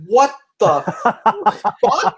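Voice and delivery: high, drawn-out shock; stunned disbelief. Retold or imitated. Worker backing up from the customer's insult, stunned disbelief. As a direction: stretch each word slowly in disbelief.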